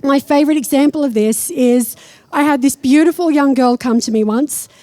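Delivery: usual speaking voice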